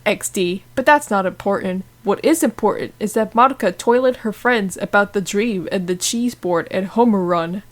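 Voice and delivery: ordinary delivery, nothing marked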